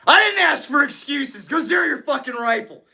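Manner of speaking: high shouted rebuke; high mock shout; mock yelling. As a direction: shout down the excuse. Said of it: "They all think you're yelling at me".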